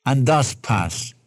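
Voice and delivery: low, heartfelt plea; earnest pleading. Real, not acted. Preacher appealing to listeners to trust the Savior, pleading. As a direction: drop the pitch and appeal earnestly. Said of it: "I plead with your heart".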